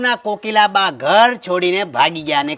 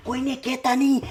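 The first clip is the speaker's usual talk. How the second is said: much softer, confiding; hushed warning not to tell; secretive aside; 3 semitones higher, 7 dB quieter than usual. Acted. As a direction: drop the voice to keep the secret.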